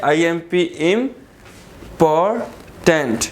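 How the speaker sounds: Important very slowly as इम, पॉर, tent; long pauses between the three syllables of important. Shown as a demonstration. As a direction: sound out important in three separate beats. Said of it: "यानी three syllable है".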